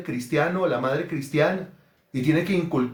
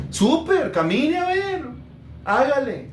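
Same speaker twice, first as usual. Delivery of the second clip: high-pitched, excited yes; eager acceptance; 9 semitones higher, slower than usual. Acted. Mother accepting her generous daughter's invitation, high eagerness. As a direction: jump at the offer in a raised voice.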